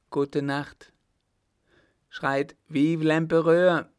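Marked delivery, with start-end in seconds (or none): 2.1-3.9 loud, higher rallying order; raised voice, ordering a cheer; commanding shout. A narrator's reading. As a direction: call out the cheer for others to follow. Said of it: "befahl der Maire".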